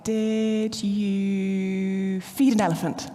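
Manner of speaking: put-on slow, drawn-out teasing question to a toddler; playful silly question. Mum teasing her daughter after nursery, slow and playful. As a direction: stretch it out like a game with a small child. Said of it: "so I'll say something like".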